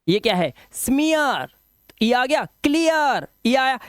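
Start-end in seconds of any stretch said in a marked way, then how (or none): 0.7-1.5 "स्मीयर" with a drawn-out ee-ya glide; ee-ya sound. Shown as a demonstration. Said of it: "वो ईया हो जाएगा". 2.4-3.3 "क्लियर" drawn out as klee-yar; slow model "क्लियर", long ee into ya; ee-ya sound. Shown as a demonstration. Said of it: "वो ईया हो जाएगा".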